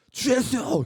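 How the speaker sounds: high, loud fervent call to the Lord; earnest shout of prayer; crying out to Jesus. Retold or imitated. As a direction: cry out in a shaking plane. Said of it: "막 옆에서 부르짖는 거예요".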